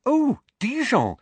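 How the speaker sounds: thrilled to recognize it; excitedly. A narrator's reading. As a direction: Hermione excited about Dijon. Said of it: "said Hermione excitedly".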